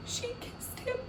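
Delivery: whispered in disbelief; whispered surprise. Shown as a demonstration. Reader to class, hushed whisper. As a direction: breathe it like a secret.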